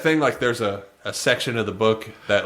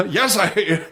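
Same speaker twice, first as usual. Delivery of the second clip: mock voiceover actor; 7 semitones higher than usual. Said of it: "he's like".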